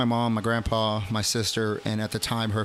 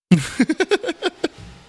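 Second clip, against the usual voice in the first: loud high-pitched laugh breaking out; high burst of laughter; 8 dB louder than usual. Real, not acted. Laughing hard at his own swearing joke, loud and high. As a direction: crack up loudly and high.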